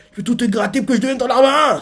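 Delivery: fast, high-pitched comeback; acted outrage rattled off high; indignant refusal. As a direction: spit out a hasty cartoonish refusal. Baggy's angry refusal acted out.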